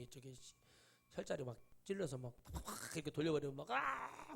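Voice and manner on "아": high, sharp mock cry of pain; imitated yelp. Retelling a junior's cry under the needle, sharp high yelp. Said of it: "막 이렇게 해가지고".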